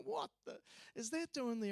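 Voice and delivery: a hunter stunned by a poodle in the bush; puzzled surprise. Retold or imitated. Tony seeing poodle in bush.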